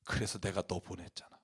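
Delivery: very low, hushed divine reply; God's voice. Retold or imitated. God answers the young helper's cry, low and very soft. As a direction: drop right down, quiet as God answering. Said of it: "그때 하나님이 주신 음성이".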